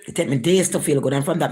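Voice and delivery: ordinary talk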